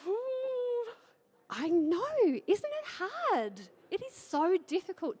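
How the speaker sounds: mock sympathy.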